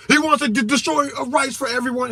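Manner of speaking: high-pitched, hammed-up outcry; mocking hysterical accuser. Mimicking his critic's overblown accusation.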